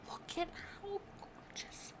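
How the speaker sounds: breathless whisper of awe; awed whisper. Real, not acted. Gamer whispering in awe at a barn wedding venue. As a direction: whisper it like it might vanish.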